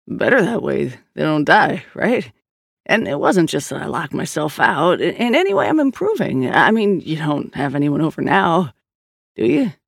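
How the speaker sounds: wheedling brother, pitch jumping up mid-protest; defensive kid brother. Acted. Brother insists to his sister he is improving, defensive and wheedling. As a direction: argue your case, then fish hopefully. Perry justifies himself, fishing.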